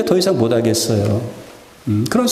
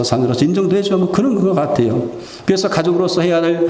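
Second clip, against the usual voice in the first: ordinary delivery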